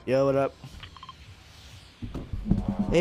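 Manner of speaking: ordinary delivery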